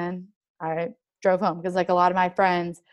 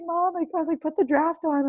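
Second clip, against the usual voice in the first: urgent plea; 9 semitones higher than usual. Retold or imitated. Retells urgent call to mom.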